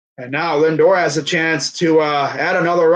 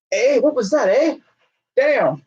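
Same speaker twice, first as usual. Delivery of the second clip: put-on Canadian accent; 7 semitones higher than usual. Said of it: "they don't speak English, I don't think, in, uh, Quebec".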